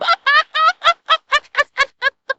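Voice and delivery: high helpless laughter. Real, not acted. Host laughing high at the gunner's mistake. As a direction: let the laugh run high.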